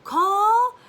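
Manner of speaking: put-on very high, loud toddler voice with an accent; imitating my daughter on a pretend phone; toddler saying call. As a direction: squeal call like a tiny toddler.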